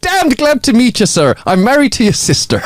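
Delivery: high-pitched, hearty Irish punchline; put-on Irish accent. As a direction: land the joke in a high Irish voice. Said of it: "the terrible Irish accent that I put on".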